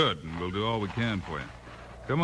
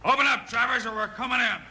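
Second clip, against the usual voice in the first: high, loud call through a locked door; shouting through door; 13 semitones higher, 5 dB louder than usual. Acted. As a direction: bark the order through the wood.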